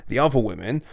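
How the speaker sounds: ordinary talk, nothing marked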